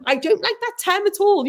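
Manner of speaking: high-pitched mimicry of a nitpicker; mock-prim objection in a high voice; mock fussy complainer. Mimics the fussy letter writer.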